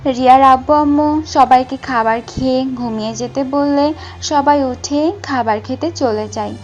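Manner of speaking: plain reading voice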